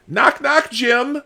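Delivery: high, loud taunting knock-knock; mocking call-out. Real, not acted. Host trying to get through to Jim, mocking. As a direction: rouse him as if nobody's home. Mock calling to absent journalist.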